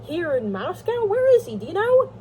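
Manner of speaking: high, rising agitated questions; urgent questioning. Acted. Levin asks where his brother is, agitated.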